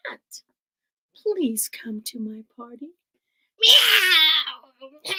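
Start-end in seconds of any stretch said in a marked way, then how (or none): 3.6-5.1 loud, far cat meow. Acted. Cat accepts the party invitation with a loud meow. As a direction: yowl it like a cat.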